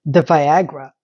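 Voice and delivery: voiced aloud, pitched well down; low-voiced reveal. Real, not acted. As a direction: say it in a low register. Repeats the whispered word aloud.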